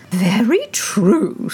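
lightly voiced old duchess; a hint of a fussy old duchess; frail withering duchess. A narrator's reading.

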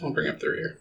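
soft unvoiced aside; whispered. Real, not acted. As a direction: whisper it under breath.

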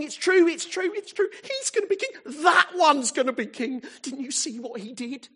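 very high, loud, jubilant shouting; joyful crowd cry. Acted. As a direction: cry it out like an ecstatic crowd hailing a king. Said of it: "They're crying".

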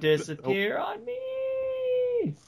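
high, slow, hammed-up sobbing plea; mock crying plea. Begging the guard not to vanish, mock sobbing. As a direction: wail it like a tearful goodbye, half in jest.

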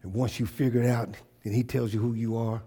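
soft low intimate sermon voice; the preacher drops to a near whisper; hushed preaching. Real, not acted. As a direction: draw them in, quietly.

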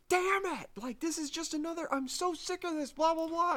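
high-pitched exasperated rant; hammed-up, squeaky frustration; mock freaking out. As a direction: imitate a frustrated man losing it. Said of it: "he's, like, freaking out".

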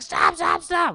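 high frantic yelling of stop; panicked shout. Retold or imitated. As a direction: scream at the driver to stop. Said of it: "she's like".

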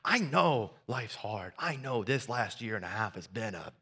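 reassuring Heavenly Father. Pretend. God reassuring his people, quiet and tender. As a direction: speak as a gentle father who has it handled. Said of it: "He's like".

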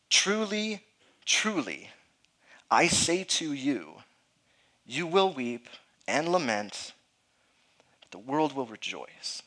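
slow, weighty reading of Jesus' words; solemn scripture reading. Acted. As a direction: let each phrase land slowly like scripture.

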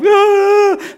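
high, loud cry of shock; startled cry. Retold or imitated. Preacher reliving seeing the Lord, a loud high startled cry. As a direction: gasp out at the sight of the Lord. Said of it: "The Lord stands there and I'm".

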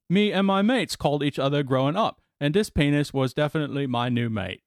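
put-on British accent quoting the patient; reading his words in a mock British accent. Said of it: "You should stop doing that accent".

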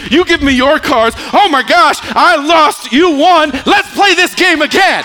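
high, loud, building to a full shout; excited preaching shout. Acted. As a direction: voice God's offer at full preaching pitch. Preacher voicing God's poker offer at climax.

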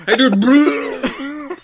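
high, slow, drawn-out surfer dude. Retold or imitated. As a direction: stretch it out like a loud beach bro. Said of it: "sounds more and more like throwing up".

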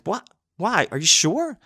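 a high, stunned rush of questions; pleading for it not to be true; disbelieving. Retold or imitated. Disbelief at cancellation.

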